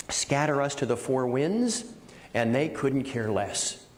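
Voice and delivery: usual speaking voice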